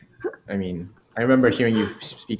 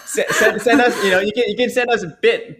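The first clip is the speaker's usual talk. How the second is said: words pushed out through laughter, high and louder; laughing while talking; 9 semitones higher, 5 dB louder than usual. Real, not acted. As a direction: keep laughing as you try to ask.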